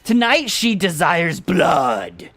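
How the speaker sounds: slow, drawn-out cult chant; playful doom voice; hammed-up demonic proclamation. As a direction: proclaim it slowly like a mock dark priest. Mock demonic proclamation about cat.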